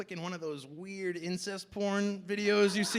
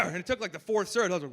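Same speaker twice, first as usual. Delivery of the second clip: usual speaking voice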